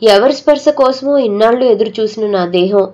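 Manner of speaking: usual speaking voice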